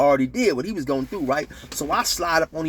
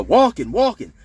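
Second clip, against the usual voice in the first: raised, louder lookout call; imitated warning yell; 6 semitones higher, 6 dB louder than usual. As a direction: call it out to warn the tier. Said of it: "who will yell".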